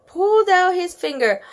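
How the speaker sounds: usual speaking voice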